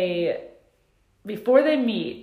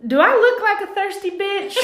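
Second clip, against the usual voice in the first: much higher, louder mock voice broken by laughter; quoting Angela; 13 semitones higher, 7 dB louder than usual. Retelling Angela's line to the cohost, high and laughing. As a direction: squeal the quote while cracking up. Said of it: "and I quote".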